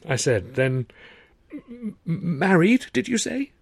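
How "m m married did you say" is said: high, choked stammer of disbelief; stammering shock. A narrator's reading. Arthur after choking on tea, stammering high. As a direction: stutter in shock.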